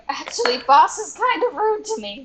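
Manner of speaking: a hint of the timid goblin. DM repeats goblin's complaint to player, light goblin voice. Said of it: "he was going".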